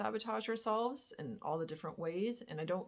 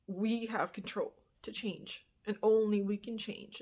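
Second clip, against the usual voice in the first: ordinary talk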